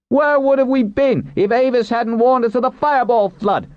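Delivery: very high shout from the crowd; loud heckling demand. A narrator's reading. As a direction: call out the challenge so everyone hears. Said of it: "demanded, loud enough to be heard".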